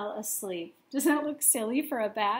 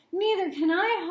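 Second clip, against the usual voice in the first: higher, louder holler; 8 semitones higher, 5 dB louder than usual. A narrator's reading. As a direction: call back in alarm. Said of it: "hollered Flitter".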